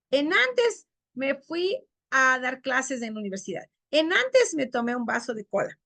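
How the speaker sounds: "En antes" said as the common mistake; teacher showing the wrong "en antes"; wrong form en antes. Shown as a demonstration. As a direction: demo the error "en antes" plainly. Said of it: "hay mucha gente que dice".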